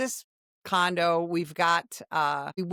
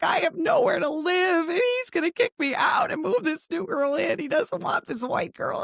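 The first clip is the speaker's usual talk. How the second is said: high, tearful mock wail; acted sobbing; 12 semitones higher than usual. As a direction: plead helplessly in a put-on cry. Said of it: "I'm sobbing".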